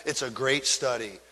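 ordinary talk

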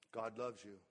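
low and soft, gently reassuring; soft reassurance. Retold or imitated. Pastor answering a troubled member, soft and reassuring. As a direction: tell them softly God loves them.

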